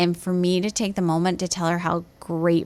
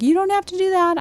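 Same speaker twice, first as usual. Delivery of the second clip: flustered protest; 13 semitones higher, 3 dB louder, faster than usual. Retold or imitated. Apologizing to helpful older lady.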